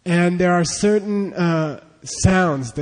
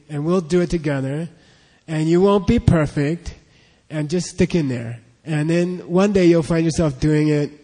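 usual speaking voice

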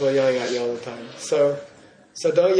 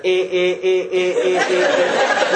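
laughing; 2 semitones higher, 3 dB louder, slower than usual. Real, not acted. Teacher laughs mid-sentence.

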